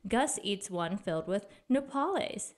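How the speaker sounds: plain reading voice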